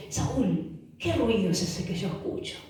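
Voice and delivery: low, hushed confrontation; grave prophet's question. Acted. Prophet Samuel confronting the king over his noise, grave and low.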